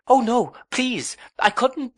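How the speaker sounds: high, begging little voice; pleading young wife. A narrator's reading. Young wife begs her husband to spare her, high pleading. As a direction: beg him not to make you, high and small.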